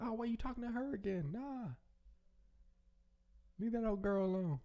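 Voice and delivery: high-pitched mock mother butting in; meddling mama. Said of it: "she's like".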